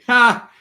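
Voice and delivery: one loud, high, derisive ha; scoffing laugh. Real, not acted. Streamer scoffing at being called angry, loud derisive laugh. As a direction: bark a single scornful laugh.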